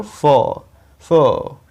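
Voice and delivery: फो drawn out, with no r; held final vowel. Shown as a demonstration. Teacher isolates the last syllable, फो held long. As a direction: linger on the vowel of फो. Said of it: "यहाँ पे थोड़ा सा रुकना है".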